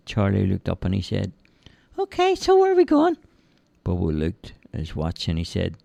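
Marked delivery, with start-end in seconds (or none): 2.0-3.2 put-on high, childlike simple lad voice; cheerful high-pitched lad; Charlie's voice. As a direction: play the big innocent lug, high and eager. Charlie asks again.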